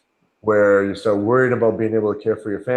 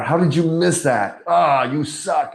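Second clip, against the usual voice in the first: higher-pitched, exasperated self-scolding; mock self-berating yell; 6 semitones higher, 3 dB louder, slower than usual. Man to his mirror over mistakes, mock yelling. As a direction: imitate scolding yourself, drawing out the insult. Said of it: "I'm yelling it at the mirror".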